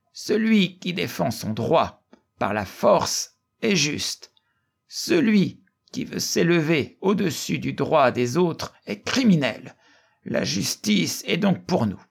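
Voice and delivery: high-pitched rhetorical declaration; declaiming orator. A narrator's reading. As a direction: proclaim a principle to the assembly. Narrator voices the orator's speech.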